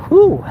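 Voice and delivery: high, loud puff of air; loud winded whew. Real, not acted. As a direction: blow it out hard, winded. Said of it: "I'll try to catch my breath".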